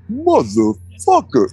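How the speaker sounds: stretched out very slowly, stunned; like a slowed-down tape; slow-motion curse. Retold or imitated. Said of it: "it's just slow".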